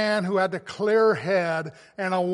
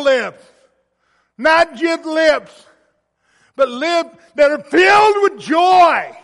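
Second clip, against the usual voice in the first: loud, slow, ringing preacher's voice, building to a shout; preaching at full force; 3 semitones higher, 9 dB louder than usual. Real, not acted. Pastor urging his congregation to joy, full-force preaching. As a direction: preach it out to the back pews. Preaching on Psalm sixty-three.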